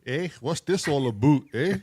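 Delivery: loud, high mock Canadian with a big eh; hammed-up Canadian, pushing every eh; Canadian accent. Greeting the stream visitor in a loud mock Canadian accent. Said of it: "community that's Canadian".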